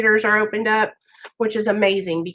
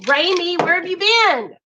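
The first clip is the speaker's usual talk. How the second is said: high, happy call to a missed friend; delighted greeting; 8 semitones higher than usual. Real, not acted. Host greeting a viewer who has been away, delighted high voice. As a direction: call out brightly, pitch way up.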